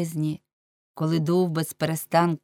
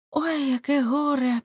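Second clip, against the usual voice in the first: woeful lament; 9 semitones higher, slower than usual. A narrator's reading. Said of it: "сумно сказала, наче її щось гнітило".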